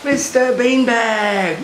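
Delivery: put-on drawn-out playful call to the toddler; slow teasing shout at play time. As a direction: stretch it out slowly, teasing. Playing monster game with child.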